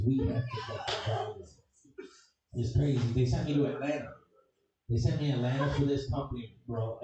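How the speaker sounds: normal talking voice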